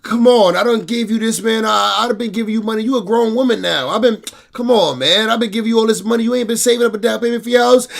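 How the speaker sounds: mock dad pushing back on his grown daughter; exasperated dad. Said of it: "Dad be like".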